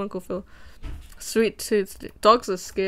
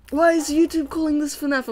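higher, puzzled viewer voice; mocking confused viewer; 5 semitones higher, 3 dB louder than usual. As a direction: play a baffled commenter. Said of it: "I love it when people are like".

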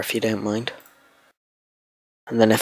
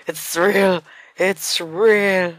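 excited; 6 semitones higher, much slower than usual. Real, not acted. Elated, repeating it's real.

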